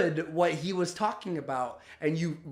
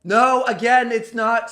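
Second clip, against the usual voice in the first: exasperated no; 4 semitones higher, 9 dB louder than usual. Real, not acted. Exasperated reply to clip.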